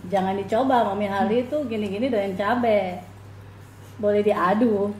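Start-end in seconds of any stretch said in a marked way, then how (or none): none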